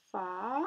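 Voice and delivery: "fa" held long, rising at the end; loud, drawn-out "fa"; sounded-out syllable. Shown as a demonstration. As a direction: isolate and stretch "fa" for readers. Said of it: "Essayez de le lire".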